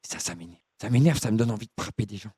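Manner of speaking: low, halting, lonely oddball; dopey confused voice. Acted. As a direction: play a muddled lonely man rambling. Keeps confused caller character.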